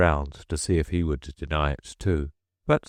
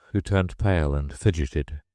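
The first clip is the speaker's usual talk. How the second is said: ordinary delivery, nothing marked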